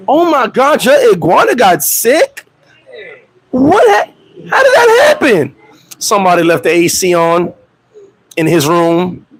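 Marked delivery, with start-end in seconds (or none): 0.1-5.6 high, overdone gasping disbelief; mock shock. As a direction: play the shocked friend, way up high. Mock shocked friend reacting.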